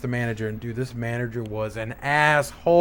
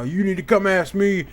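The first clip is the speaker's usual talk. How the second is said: mock yell; 6 semitones higher than usual. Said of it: "He starts yelling at me".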